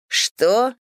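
high surprised shout of Что?; surprised cry. A narrator's reading. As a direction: blurt it out, shocked. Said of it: "вскричали близнецы".

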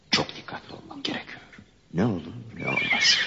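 soft, guarded aside; hushed, cautious self-talk; wary muttering to self. Acted. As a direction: murmur it warily to yourself. Wary man talking to himself.

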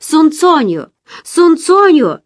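narrated cry for someone's attention; calling out. A narrator's reading. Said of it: "Я крикнула".

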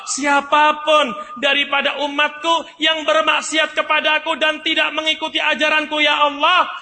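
a prophet begging loudly for his people; pleading shout. Acted. Ibrahim pleads aloud to God for his people, high, loud pleading cry.

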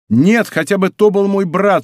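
high, fervent exclamation; rapturous cry. A narrator's reading. Admirer vowing to the lady he would spare nobody, rapturous. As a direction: burst out as if swept away by rapture. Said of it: "как бы в порыве восторга".

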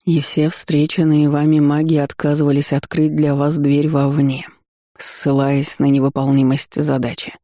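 ordinary delivery

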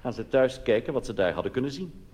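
ordinary talk, nothing marked

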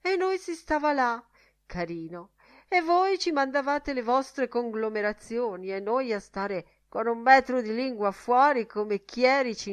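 loud, high-pitched moan; moaning complaint. A narrator's reading. Mulligan lamenting their thirsty wait, moaning complaint. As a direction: wail the grievance, mock pitiful.

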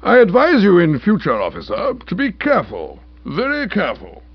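high, loud pompous indignation; outraged rebuke. A narrator's reading. Uncle warning the officer to be careful, outraged. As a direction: lay down the law indignantly.

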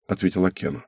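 ordinary delivery, nothing marked

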